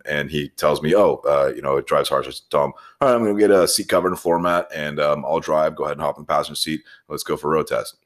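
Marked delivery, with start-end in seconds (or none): none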